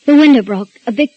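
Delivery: injured woman gasping out her injury; panicked and hurt. Acted. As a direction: blurt it out hurt and frightened. Injured wife on the phone.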